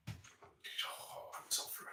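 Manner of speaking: whispered through mock rage; barely audible, fuming; seething whisper. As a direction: breathe the words out, no voice. Seething over the executed daughter.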